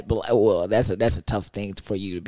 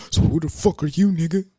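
a hint of a hostile boyfriend, slightly higher; mock tough guy; 6 semitones higher than usual. Jealous boyfriend confronting the stranger, mock hostile. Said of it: "he keeps saying".

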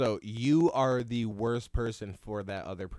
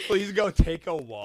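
imitated teacher; 7 semitones higher, 4 dB louder than usual. Mimics the fed-up teacher.

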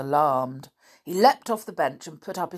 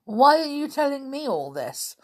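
high, louder scornful question; 10 semitones higher, 5 dB louder than usual. A narrator's reading. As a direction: throw the question back with scorn. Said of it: "said Uncle Quentin scornfully".

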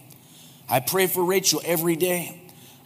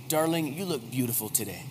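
tender compliment; 6 semitones lower, 8 dB quieter, faster than usual. Shown as a demonstration.

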